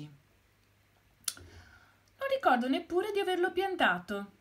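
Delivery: drawn-out bewildered hen; puzzled hen. Acted.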